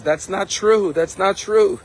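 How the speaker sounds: high, emphatic retold denial; indignant outburst. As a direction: protest it hotly, as if banging the table. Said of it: "bangs on the table and says".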